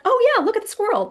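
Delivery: high playful go-along; mock encouraging mom. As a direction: lightly imitate the mom's cheerful reply. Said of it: "You was like".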